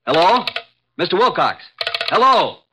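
high, loud calls into the phone; calling out urgently. Acted. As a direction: try to raise a caller who has gone silent. Calling to silent caller after shot.

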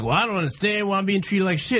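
mock whine, high and quick; whiny. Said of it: "whiny ass titty baby syndrome".

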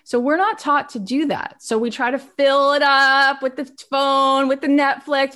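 normal talking voice

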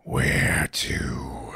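low unvoiced skeletal rasp; creepy whisper. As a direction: whisper like a crucified corpse. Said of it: "and says".

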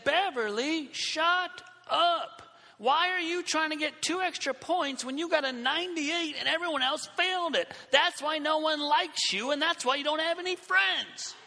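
high-pitched re-enacted tirade; yelling. Student telling off classmate before whole class, yelling. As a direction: let loose an exasperated rant, pitch held high. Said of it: "I yell at her in front of the whole class".

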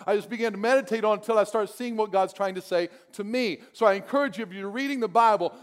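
normal talking voice

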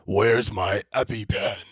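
slow choking growl, peanut stuck in throat; mock Batman fighting for air. Shown as a demonstration. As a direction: wheeze it out slowly like a choking superhero. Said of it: "sounds like I lodged, like, a peanut".

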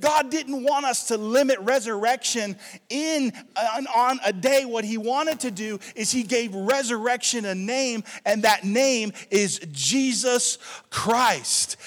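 loud, high-pitched, fired-up sermon; full-force preaching. Acted. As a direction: drive the point home at full voice.

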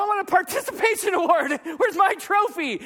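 high-pitched mock tantrum; petulant demand. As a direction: demand your reward like a spoiled kid. Husband demands praise from wife.

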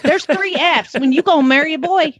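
put-on lightly drawn Southern grandma accent. Grandma advising a young bride, in a Southern accent. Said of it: "I could actually do it in the accent".

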